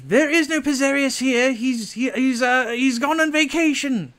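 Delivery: high, loud disguised voice from inside; fake denial voice. Pizarius lies through the door that he's away, high put-on yell. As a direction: pretend to be someone else, loudly. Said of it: "It's like".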